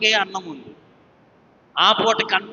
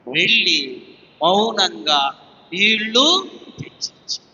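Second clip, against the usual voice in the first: usual speaking voice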